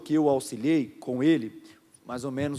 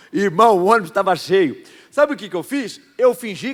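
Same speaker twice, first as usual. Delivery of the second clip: laughing while loud; 3 semitones higher, 9 dB louder than usual. Real, not acted. Pastor laughing telling the congregation the story. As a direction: talk through laughter.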